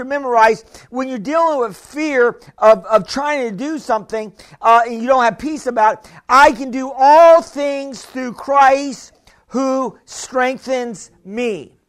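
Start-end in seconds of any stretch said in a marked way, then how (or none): 6.3-11.8 slow, weighty recitation, each word set down; slow preached scripture. Acted. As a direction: proclaim it slowly to the church.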